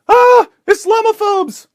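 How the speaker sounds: high shrieking mock scream; hammed-up high panicked yell. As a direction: shriek it like a hysterical reporter. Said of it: "comes to us and screams".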